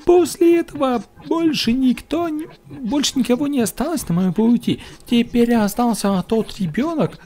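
high put-on woman's voice; female voice. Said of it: "женский голос".

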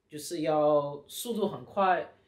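normal talking voice

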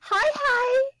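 put-on high, chirpy girl greeting; high girly voice. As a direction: pitch way up for a girl. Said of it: "read the lady in voices".

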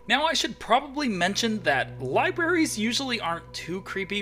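loud, high, hyped recap; excited storytelling. Acted. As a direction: tell it big and breathless.

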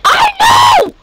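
high, loud yell; loud high-pitched retort; exasperated shout. Real, not acted. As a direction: shout back over the game. Yelling back during game fight.

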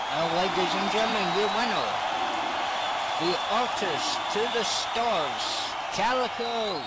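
loud, high winner announcement; big ringside announcer voice; ring announcer. Acted. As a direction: proclaim the champion, drawing out the name.

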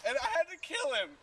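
manic confession. Acted. Unhinged rant about deer.

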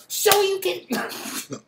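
high, very slow, drawn-out fighting-game shout; Ryu impression. Ex posing like Ryu from Street Fighter, slow hammed-up game shout. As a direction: ham it up like a Street Fighter character. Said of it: "she was wanna be like Ryu from fucking Street Fighter".

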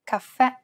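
caffè with the last syllable stressed; stress on final vowel. Shown as a demonstration. Host shows grave accent examples, final è stressed in caffè. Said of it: "end of a word can have a grave accent".

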